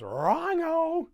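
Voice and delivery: high, loud drawn-out cry; Grinch's mock holler. Grinch throwing Max out, high mock holler. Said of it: "just goes".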